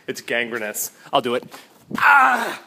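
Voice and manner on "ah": high, loud "ah" yell; mock scream. Vlogger touches the gangrenous roof, mock scream.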